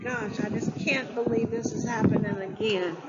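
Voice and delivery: ordinary talk, nothing marked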